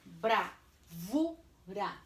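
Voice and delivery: "bravura" slow, in separate syllables. Shown as a demonstration. Teacher dictates the last word, "bravura" syllable by syllable. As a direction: dictate "bravura" with gaps after each syllable. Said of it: "eu vou separar".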